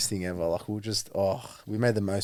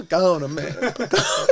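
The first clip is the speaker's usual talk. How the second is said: high-pitched imitation of a Spanish guy; little Spanish guy; 9 semitones higher, 4 dB louder than usual. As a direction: imitate the small Spanish guy's protest, climbing high. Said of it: "little Spanish guy".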